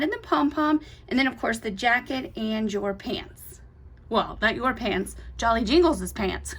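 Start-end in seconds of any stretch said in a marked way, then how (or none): none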